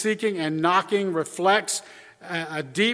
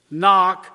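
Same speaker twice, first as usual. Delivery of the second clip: a single loud, emphatic KNOCK; emphasis on KNOCK; 6 dB louder than usual. Shown as a demonstration. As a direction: KNOCK punched loud, standing alone. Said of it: "that's the emphasis".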